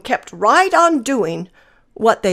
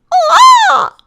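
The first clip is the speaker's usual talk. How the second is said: high loud mocking shriek; mock peacock scream; 6 dB louder than usual. Hen mocks the peacock's screaming, high and loud. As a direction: mimic his screeching with scorn. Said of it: "struts around screaming".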